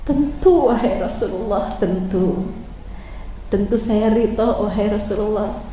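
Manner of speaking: tearful reply. Acted. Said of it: "dalam isak tangisnya".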